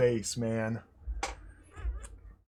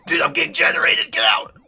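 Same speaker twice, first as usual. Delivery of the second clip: high, loud, fast yelling; panicked shout; 14 dB louder, faster than usual. Real, not acted. Player panics in the prohibited zone with a high, fast, loud shout. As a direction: yell in alarm as the danger closes in.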